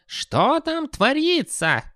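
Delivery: put-on high, slow, querulous old man; shouting old wizard. A narrator's reading. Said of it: "крикнул он".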